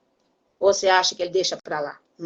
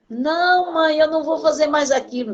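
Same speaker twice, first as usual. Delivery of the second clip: put-on higher, childlike plea; pleading child; 7 semitones higher than usual. Son pleads with his mother not to be punished. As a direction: beg like a scared little boy.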